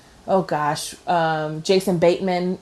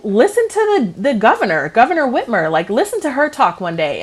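higher, louder, animated insistence; insistent retold argument; 6 semitones higher, 5 dB louder than usual. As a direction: argue your point emphatically, pitch jumping up. Retelling a playful argument.

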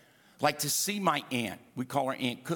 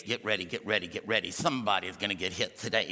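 much lower, booming preacher build-up; T D Jakes impression; 7 semitones lower, faster than usual. Youth imitating a famous preacher, much lower and fast. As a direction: growl it out like a revival preacher. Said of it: "imitate T D Jakes".

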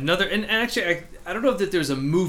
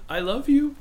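high, tender "I love you"; tearful girl dog; 13 semitones higher than usual. Retold or imitated. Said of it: "she's like".

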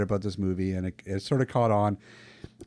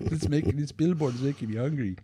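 put-on Mexican accent; 3 semitones higher than usual. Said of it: "the worst Mexican accent I've ever heard".

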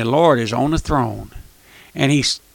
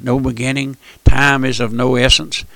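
normal talking voice

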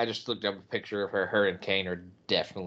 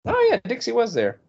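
excited; 14 semitones higher, 5 dB louder than usual. Real, not acted. Sudden excited recognition.

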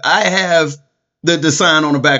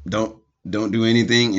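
ordinary talk, nothing marked

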